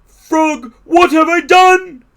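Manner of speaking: very high, loud wail; distressed cry. A narrator's reading. Toad realizes Frog has vanished, high distressed cry. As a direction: cry out in alarm at what you caused. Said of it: "cried Toad".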